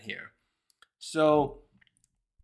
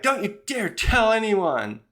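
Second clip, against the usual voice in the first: higher, slow, drawn-out acted threat; indignant mock warning; 6 semitones higher, 3 dB louder than usual. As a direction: warn them off in mock outrage, stretching the words. Acted warning to a teasing friend.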